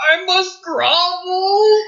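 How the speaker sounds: high, slow, wailing beg; wailing plea. Acted. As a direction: wail it out like a broken man. NPC groveling, crying sound.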